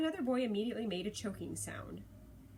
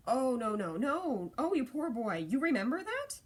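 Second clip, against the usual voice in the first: loud, swooping cry of pity; dismayed sympathy; 4 semitones higher, 6 dB louder than usual. A narrator's reading. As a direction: gush with pity and surprise, louder and higher. Granny realizes boy's misunderstanding.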